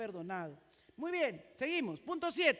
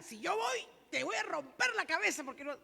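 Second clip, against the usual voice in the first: mock threat; 5 semitones higher than usual. Shown as a demonstration. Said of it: "Cambiemos las reglas".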